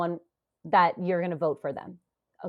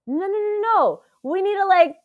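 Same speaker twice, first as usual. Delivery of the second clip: urgent objection; 11 semitones higher, 6 dB louder than usual. Retold or imitated. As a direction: halt everyone in exasperated protest. Acting out own urgent objection.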